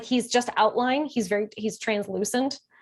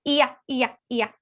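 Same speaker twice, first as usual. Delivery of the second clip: higher chanted iya sounds; channeled vocalizing; 4 semitones higher, 3 dB louder than usual. Retold or imitated. Imitating the being vocalizing through her, a lightly voiced chant. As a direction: let the syllables come through you. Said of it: "he started out with".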